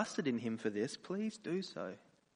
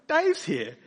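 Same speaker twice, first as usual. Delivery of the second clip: mock cocky relief; 7 semitones higher, 8 dB louder than usual. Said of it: "It's like".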